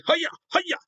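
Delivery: very high, loud mock karate cries; karate yells. Fan acting out the karate fight, mock kiai yells. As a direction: chop the air with cartoon kiai shouts.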